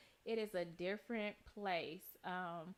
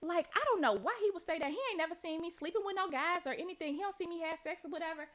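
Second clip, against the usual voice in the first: mocking indignation; 6 semitones higher, 4 dB louder than usual. Mocking the other mother's defense.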